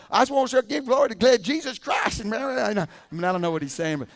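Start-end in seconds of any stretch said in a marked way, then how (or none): 0.1-2.8 higher, louder mock Southern coach accent, hammed up; imitating Dabo's accent. Preacher mimics the coach's accent in an interview, hammed up. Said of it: "I can hardly understand through his accent".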